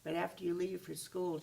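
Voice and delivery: ordinary delivery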